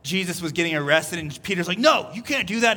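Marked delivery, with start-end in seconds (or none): 1.8-2.8 higher, punched retold objection; mock outraged protest. Peter objecting to Jesus's arrest, mock outrage. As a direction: burst out with an acted "no".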